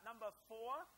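ordinary delivery

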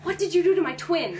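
high, loud retold demand; imitated intense confrontation; intense accusation. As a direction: hit it hard, as a mock-dramatic line. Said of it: "really intensely".

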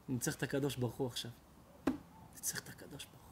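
soft, fading to a whisper; almost whisper it to God; hushed desperate plea to God. Acted. Rabbi sends all away, whispers.

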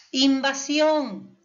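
Invasión slow and loud, leaning on SIÓN; stress on last syllable. Shown as a demonstration. Teacher showing the última sílaba, Invasión stressed hard on SIÓN. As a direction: draw out and hit SIÓN in Invasión. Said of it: "última sílaba".

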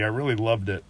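ordinary delivery

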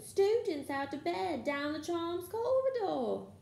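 high, drawn-out alarm call; bellowing. A narrator's reading. Poltergeist giving away the students' hiding place, high bellow. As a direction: call it slowly down the halls. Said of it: "Peeves bellowed".

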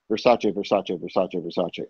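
low, hushed repeating; soft, deep running tally; muttered aside. Retold or imitated. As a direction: chant the brand under your breath. Listing labels on manager's outfit.

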